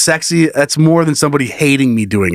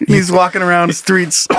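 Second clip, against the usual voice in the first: normal talking voice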